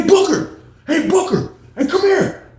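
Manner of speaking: high shouted refusal; shouting. Retold or imitated. Calling Booker over.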